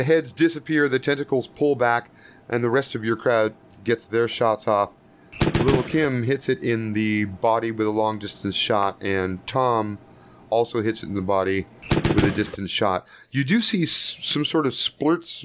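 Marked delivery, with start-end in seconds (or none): none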